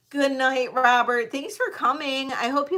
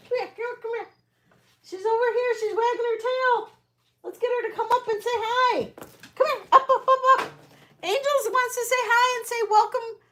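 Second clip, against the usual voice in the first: high sweet dog-calling voice; talking to the dog; 11 semitones higher than usual. Real, not acted. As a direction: coax the dog over, higher.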